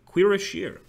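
Quiroshir pitched high, in surprise; trying the pronunciation. Shown as a demonstration. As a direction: try out Quiroshir as "kwee-ra-SHEER". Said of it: "is pronounced Quiroshir".

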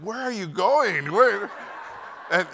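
high, loud questioning dad; comic high-pitched fatherly outburst; mock indignant father. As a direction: put on a worked-up dad, playing for laughs. Father's demand acted for laughs.

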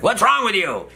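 high-pitched mock old doctor; imitating brusque old doctor. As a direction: blurt it high, like a cranky doctor. Old doctor's blunt question retold.

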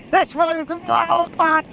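high, laughing thrill at the road; can't contain the joy, laughing through it; giddy excitement. Real, not acted. Rider laughing at a wild road, giddy and thrilled. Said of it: "I'm like a big kid".

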